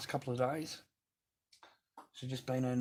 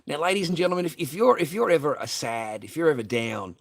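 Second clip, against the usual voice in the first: louder, higher showman call; mock announcer; 4 semitones higher, 7 dB louder than usual. Streamer addressing viewers, mock showman announcement. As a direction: ham it up like a compere.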